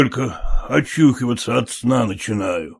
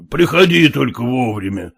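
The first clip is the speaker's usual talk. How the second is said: ordinary delivery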